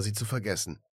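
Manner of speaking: usual speaking voice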